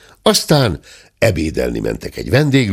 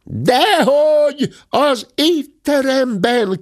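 yelled at the top of her lungs; slower than usual. A narrator's reading. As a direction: scream it with full throat. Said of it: "kiáltotta torka szakadtából".

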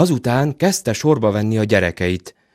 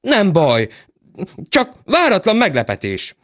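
high flustered steward; excited; 11 semitones higher than usual. Acted. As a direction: blurt it out in a fluster.

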